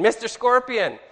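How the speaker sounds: high, loud storytelling call; indignant cry. Retold or imitated. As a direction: call out the name like a wronged frog. Stung frog confronts the scorpion.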